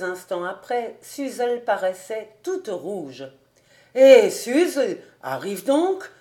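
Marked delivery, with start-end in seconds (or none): 3.9-6.1 loud, drawn-out call to someone away. A narrator's reading. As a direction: call her over, loud and higher at the start. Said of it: "lui cria Cobus".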